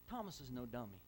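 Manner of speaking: usual speaking voice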